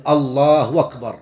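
"أكبر" begun with a w, no glottal stop; hamza turned to waw. Shown as a demonstration. Teacher showing muezzins' error, "أكبر" said as wakbar. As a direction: glide "الله أكبر" into "allaahu wakbar". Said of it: "إبدال الهمزة واوا".

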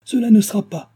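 high, loud desperate refusal; desperate cry. A narrator's reading. Henriette refusing to be dragged from the sickroom, desperate cry. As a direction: cry out in despair, pitch leaping up. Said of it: "s'écria-t-elle désespérée".

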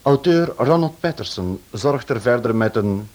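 ordinary delivery

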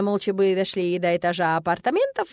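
ordinary delivery

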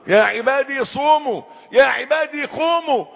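ordinary talk

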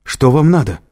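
panicked high cry; frightened shout at the attacker; terrified. A narrator's reading. Триш в ужасе. (испуганный крик)

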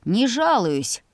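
snorting retort. A narrator's reading. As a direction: brush it off with mild scorn. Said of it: "фыркнул он".

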